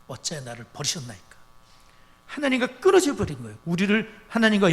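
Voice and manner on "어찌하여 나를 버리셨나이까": softer and lower, 어찌하여 sinking at the end; quiet, broken echo of the cry; quiet forsaken plea. Retold or imitated. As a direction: let it fall almost to a murmur. Quoting Jesus on the cross.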